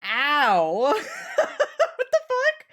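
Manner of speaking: loud ow, then a high laughing what the fuck; yelp, then laugh it off in disbelief; pained yelp into laughter. Real, not acted. Streamer hurts her knuckle and laughs, loud pained yelp. Ring hit knuckle, real pain.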